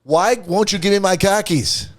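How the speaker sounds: loud, high Boston accent, car keys as khakis. Shown as a demonstration. Host showing Boston speech to cohost, Boston accent. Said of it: "speak like someone from Boston".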